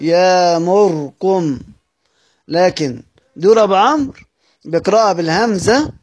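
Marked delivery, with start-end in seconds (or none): 0.0-1.6 يامركم with no hamza and a silent ra, very slow; dropped hamza, silent ra. Shown as a demonstration. Teacher demonstrates al-Susi's reading of يامركم, slowly chanted. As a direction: chant يامركم slowly, long alif, no vowel on the ra. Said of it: "هيقرأها بإبدال الهمزة مع إسكان الراء".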